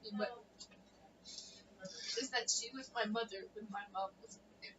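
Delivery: soft, subdued, on the edge of tears; tearful. Real, not acted. Remembering a late aunt to friends, softly tearful. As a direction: hold back tears, quietly, with pauses. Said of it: "stop crying".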